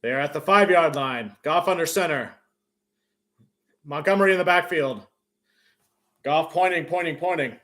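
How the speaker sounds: normal talking voice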